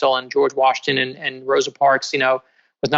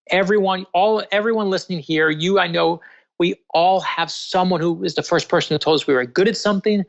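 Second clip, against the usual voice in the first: normal talking voice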